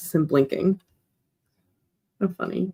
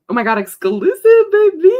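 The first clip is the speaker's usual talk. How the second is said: very high, loud shriek of delight; thrilled squeal; excited shriek; 13 semitones higher, 11 dB louder than usual. Real, not acted. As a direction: scream it with joy. Excited reaction to news.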